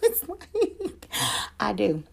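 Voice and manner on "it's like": real laugh. Real, not acted. Laughs at friend's teasing.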